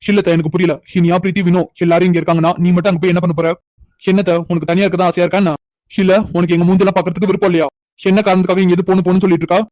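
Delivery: loud, frustrated pleading with aunt. Acted. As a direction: argue hard to make her stay. Nephew arguing she shouldn't leave.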